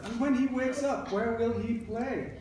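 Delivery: put-on a mother's question read to children, pitched high; mother asking. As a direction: ask it as the boy's mom, lifted up. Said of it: "And then his mother said".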